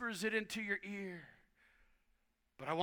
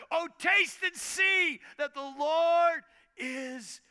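high, slow, ringing shout; 9 semitones higher, 8 dB louder, much slower than usual. Real, not acted. Preacher crying out scripture to the congregation, high slow shout. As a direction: proclaim it full force.

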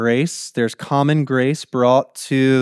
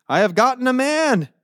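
higher, proud exclamation; exclaiming Eve; 10 semitones higher than usual. Acted. Preacher reading Eve's birth announcement, exclaiming. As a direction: read it out as a mildly hammed-up cry.

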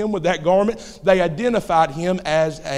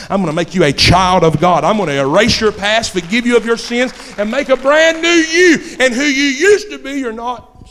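loud voice climbing higher with each promise; full-force preaching; 6 semitones higher, 9 dB louder than usual. Acted. Jesus promising the sinner a new life, preached at full force. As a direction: build to a high, ringing declaration.

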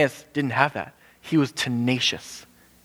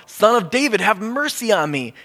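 pleading call from the roadside; 8 semitones higher than usual. Retold or imitated. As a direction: call out to Jesus over the crowd. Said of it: "So he calls out".

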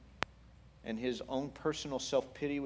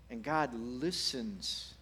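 ordinary delivery

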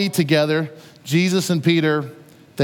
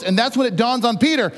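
normal talking voice

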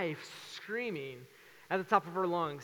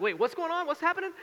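high, louder frantic critter voice; panicked frog; 11 semitones higher, 7 dB louder than usual. Retold or imitated. Frog grabbed by the pastor, high frantic squeak. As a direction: squeak out the frog's confusion.

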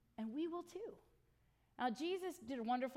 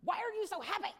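baffled; 6 semitones higher, 8 dB louder than usual. Retold or imitated. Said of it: "And they're like".

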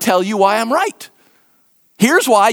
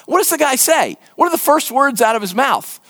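ordinary talk, nothing marked

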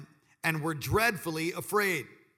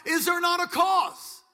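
much higher, louder and slow; full-force preaching of is there not a cause; preached shout; 13 semitones higher, 7 dB louder than usual. Acted. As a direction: proclaim it to the congregation. Preacher repeats line at full force.

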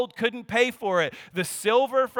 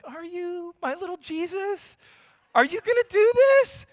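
higher, rising to a squeaky disbelieving pitch; mock incredulous dad; 8 semitones higher than usual. Father teasing his son for taking the blame, mock amazed. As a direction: tease him with exaggerated surprise. Dad mocking son's sacrifice.

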